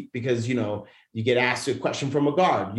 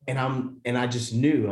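ordinary delivery

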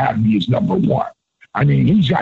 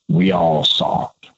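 Michael Hayes voice; 9 semitones lower than usual. Retold or imitated. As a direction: lower imitated voice of a veteran. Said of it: "could do a dead-on Michael Hayes".

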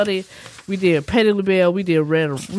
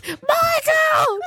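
high, drawn-out wail of a name; hammed-up high-pitched wail; imitated wailing yell. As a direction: cry out like a grieving mother. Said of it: "my mother's just yelling".

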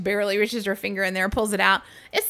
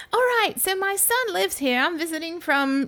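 imitating British guy; 9 semitones higher than usual. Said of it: "So he's like".

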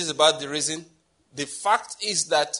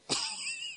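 a short, high, soft giggle; high squeaky giggle; 13 dB quieter than usual. Real, not acted. Preacher giggling at his own joke to the congregation, high and soft.

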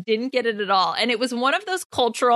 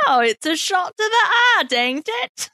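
mock British accent; 8 semitones higher than usual. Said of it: "British impersonating".

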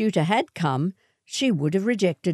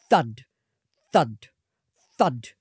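heavy thuds; 4 semitones lower than usual. A narrator's reading. Bullets hitting.

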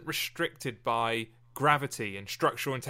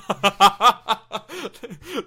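loud, high burst of laughter; real, uncontained laughing fit; hearty laugh; 9 semitones higher, 15 dB louder than usual. Real, not acted. Laughing at the David Cameron joke.

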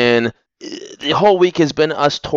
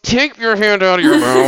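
mock parent shout; 10 semitones higher than usual. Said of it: "I'm just like".

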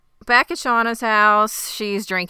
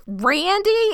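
high-pitched disbelieving question; incredulous echo; 12 semitones higher, 4 dB louder than usual. Acted. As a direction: repeat the name in mock shock. Wife's shocked reply in retelling.

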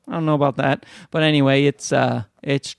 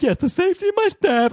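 very high, hammed-up imitation; mock panicky manager. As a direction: mimic her alarm in a high voice. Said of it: "she starts, like, freaking out".

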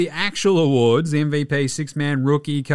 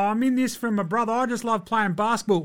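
higher, quick mock whine; mock whiny quote; 6 semitones higher than usual. Mocking Kyrie's excuses, whiny and quick. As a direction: sneer through a whiny imitation.

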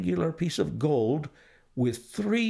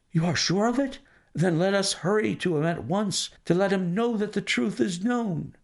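high, breathless anxious questions; banker's voice pitched high with urgency; eager banker; 8 semitones higher, faster than usual. A narrator's reading. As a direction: clutch at any hope.

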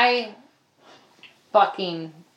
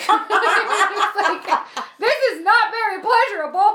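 high, loud laughing admission; 9 semitones higher, 6 dB louder, slower than usual. Real, not acted. Host laughing about watching bad movies, high and loud. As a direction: laugh through the confession.

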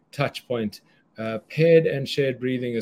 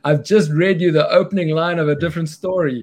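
laughing at own mistake; 6 semitones higher, 9 dB louder than usual. Real, not acted. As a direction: giggle through the words.

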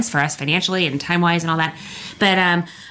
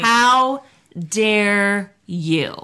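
drawn-out dramatic indignation; mock outrage; much slower than usual. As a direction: stretch it out, scandalised.

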